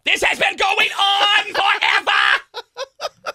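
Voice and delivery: put-on high, loud, slow German accent; mock German shout. Cohost mocking loud Germans, slow shouted German accent. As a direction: lean on each word, German style.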